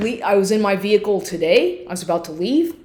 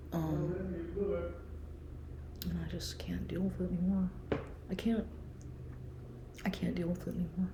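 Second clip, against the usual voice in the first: very soft, half-whispered talk; hushed whisper; 3 semitones lower, 19 dB quieter than usual. Real, not acted. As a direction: keep it down so nobody at the door hears. Hiding from knock at door.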